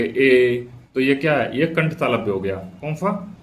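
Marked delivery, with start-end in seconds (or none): 0.0-0.7 ए held, from throat and palate; throat-and-palate vowel. Shown as a demonstration. Teacher showing where the vowel forms, drawn-out ए. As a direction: sustain ए between throat and palate. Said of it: "गले से भी बोला जाए और जिसको तालु पे भी लगे".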